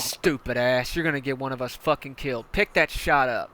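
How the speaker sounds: loud berating; angry commander. Acted. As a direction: chew him out loudly.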